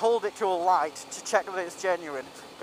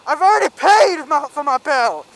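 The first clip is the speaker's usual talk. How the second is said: imitated drunken yell, much higher and louder; retold yell; 7 semitones higher, 11 dB louder than usual. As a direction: bellow it like an outraged customer. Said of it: "he just yells at me".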